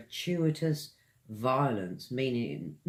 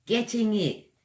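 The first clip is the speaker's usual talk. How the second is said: Getting it in crisp proper British, hard t; proper British accent; 8 semitones higher than usual. Shown as a demonstration. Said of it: "one in a proper British accent".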